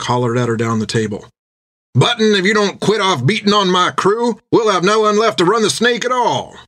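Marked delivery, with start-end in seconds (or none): 1.9-6.6 high, hearty captain's voice; a big captain scolding with a grin; jovial captain. Acted. Jack hollering to Finn down the table, hearty captain.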